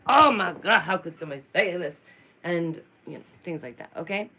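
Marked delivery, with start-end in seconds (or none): 0.1-2.0 loud, shocked, fast gasp of outrage; hammed-up shocked clutch-the-pearls; mock outrage. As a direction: play the scandalised algorithm, loud and fast. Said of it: "the algorithm will be like".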